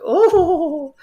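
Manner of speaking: high, loud mock squeal; drawn-out, very high fan-girl cry; imitated excited girls. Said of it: "this table of, like, these Japanese girls".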